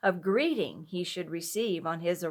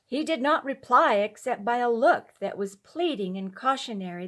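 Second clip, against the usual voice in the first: plain reading voice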